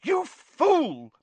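high, loud outburst; cried out. A narrator's reading. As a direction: cry out furious at him. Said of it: "Professor McGonagall cried".

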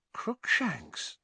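uncertain whisper. A narrator's reading. Hermione calling to the cat in shock, hushed and unsure. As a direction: call the cat's name under your breath. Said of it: "Hermione whispered uncertainly".

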